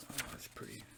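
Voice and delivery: normal talking voice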